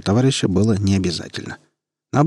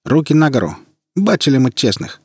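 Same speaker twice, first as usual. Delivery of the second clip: higher, louder barked order; loud challenge; 6 semitones higher, 5 dB louder than usual. Acted. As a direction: shout the order at a stranger. Sentry challenges at gunpoint.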